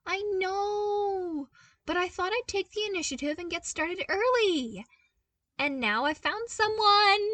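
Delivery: put-on upbeat young voice, proud of his find; eager young man. A narrator's reading. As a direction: pitch it up like an excited young engineer. Luke's voice in reading.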